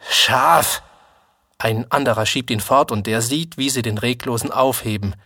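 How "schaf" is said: scornful insult. A narrator's reading. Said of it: "Jemand sagt ihm".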